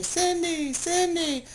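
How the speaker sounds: a high, mock beckoning sing-song; lightly voiced chickens luring her; chickens calling her name. Rotisserie chickens calling to a hungry shopper, high mock sing-song. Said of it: "calling my name".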